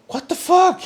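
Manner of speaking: very high-pitched disbelief; incredulous. Retold or imitated. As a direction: squeak it out, half laughing in disbelief. Retold disbelief at the intrusion.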